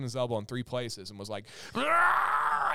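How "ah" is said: loud high yell of pain; pained scream. Retold or imitated. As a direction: scream as if forcing a broken arm straight. Child screaming as he straightens elbow.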